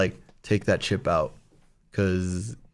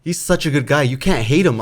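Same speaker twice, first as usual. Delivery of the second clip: ordinary delivery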